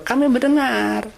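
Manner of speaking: mock the people who say they hear; mock Israelite reply. Preacher mocking the Israelites who hear but disobey, hammed-up.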